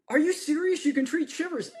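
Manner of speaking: high, incredulous questioning; retold disbelief. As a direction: imitate an amazed trainer, pitched well up. Retold trainer runs up, disbelieving.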